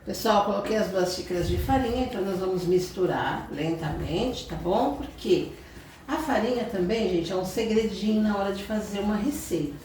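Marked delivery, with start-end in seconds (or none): none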